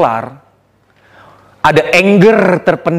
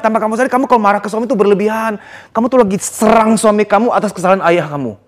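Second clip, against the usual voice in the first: usual speaking voice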